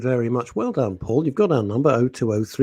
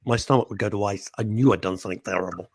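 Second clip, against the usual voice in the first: ordinary delivery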